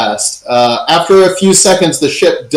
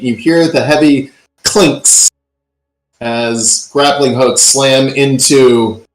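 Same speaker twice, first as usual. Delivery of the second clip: normal talking voice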